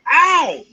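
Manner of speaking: sudden high, loud squeal of pain; pig's pained yelp. Retold or imitated. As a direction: yelp it like a shot pig. Pig hit by rifle shot.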